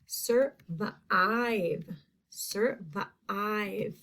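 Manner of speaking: "survive" sounded out as sur-vuh-IVE; second syllable stretched. Shown as a demonstration. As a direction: draw out the long I in "survive". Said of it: "Second syllable".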